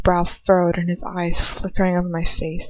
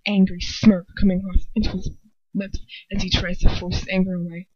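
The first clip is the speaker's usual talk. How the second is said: plain reading voice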